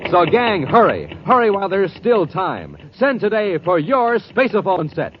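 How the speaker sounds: usual speaking voice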